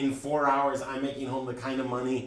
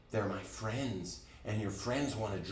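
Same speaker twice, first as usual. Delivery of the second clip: slow, soft self-mocking "they're my friends"; rueful, slow; 2 semitones lower, 6 dB quieter than usual. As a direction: drag it out, quiet and bitter at yourself. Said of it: "too stupid to think otherwise".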